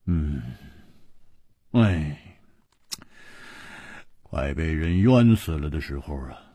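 low, weary-sounding lament; long sigh. A narrator's reading. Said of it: "长叹说". (长叹一声，低声感慨)

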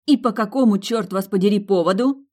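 a hint of a stern general; general's voice. A narrator's reading. Milly grilling friends like soldiers, lightly voiced general. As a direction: interrogate like an officer. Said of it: "Голосом генерала".

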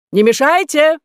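loud high rebuke; snapping shout. A narrator's reading. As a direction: shout them down. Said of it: "прикрикнул на них профессор".

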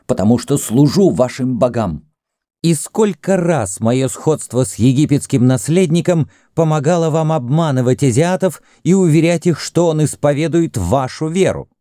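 high-pitched indignant tirade; heated indignation. A narrator's reading. Greek reminds Kama of his services, indignantly. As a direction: press the grievance with rising pitch. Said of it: "снова вспыхнул грек".

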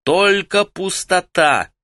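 slow, high-pitched call; shouting back. A narrator's reading. Каллулу calls up to those on the wall, shouting back. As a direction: shout it slowly so it carries upward. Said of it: "крикнул в ответ Каллулу".

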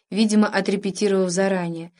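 ordinary delivery, nothing marked

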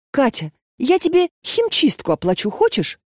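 high, eager offer; eager girl voice. A narrator's reading. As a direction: eagerly offer to make amends. Lena offers to pay.

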